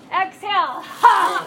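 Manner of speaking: shouted ha, high and loud. Shown as a demonstration. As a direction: yell the ha out like chopping wood. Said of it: "I know you can yell louder than that".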